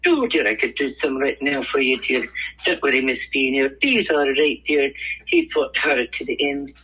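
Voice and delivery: playful celebrity impression, lifted in pitch; Robin Williams. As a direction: put on a high, kindly Mrs. Doubtfire-style voice. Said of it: "You have to do some Robin for me".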